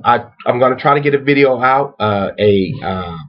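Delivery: ordinary talk, nothing marked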